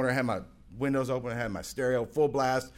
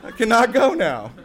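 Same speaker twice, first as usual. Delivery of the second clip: laughing; 12 semitones higher, 11 dB louder than usual. Real, not acted. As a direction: crack up at yourself. Laughing at his own contrariness.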